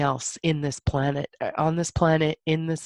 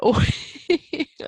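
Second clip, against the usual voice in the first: a high, emotional laugh breaking the sentence; teary laugh; 10 semitones higher than usual. Real, not acted.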